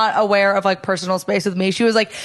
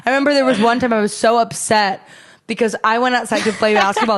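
ordinary delivery

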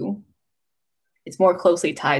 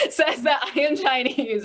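laughing; 7 semitones higher than usual. Real, not acted. Laughs about own skin tone.

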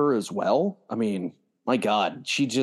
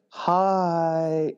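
high, drawn-out "hi"; mock casual greeting; 6 semitones higher than usual. Sneaking past the dinner table, high casual greeting. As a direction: stretch it out high and sing-song.

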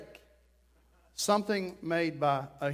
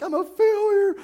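high, loud despairing wail fading down; young man wailing he is a failure; despairing cry; 5 semitones higher, 5 dB louder than usual. Retold or imitated. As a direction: cry out in failure, then trail off. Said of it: "I'm like".

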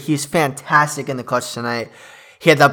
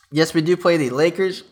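burping; 4 semitones higher than usual. Real, not acted. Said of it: "Excuse me, I'm burping again".

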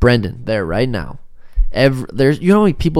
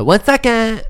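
put-on high, louder little-girl voice; toddler voice; 11 semitones higher, 5 dB louder, slower than usual. Dad imitating his daughter copying mom's phrase. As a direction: chirp it like a tiny boss. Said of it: "And Elena said".

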